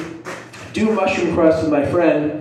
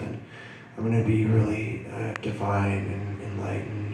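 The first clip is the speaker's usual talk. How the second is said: very soft, low, drifting voice; hushed, lower, dreamy self-talk; blissed-out inward musing; 4 semitones lower, 9 dB quieter than usual. Retold or imitated. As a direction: murmur it low, as if sinking into yourself. Acting out lying back, going inward.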